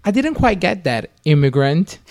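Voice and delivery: high, prim phone voice delivering an insult; imitating a phone assistant, high and smug; mock Siri voice. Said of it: "my phone is like".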